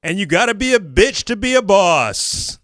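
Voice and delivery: ordinary talk, nothing marked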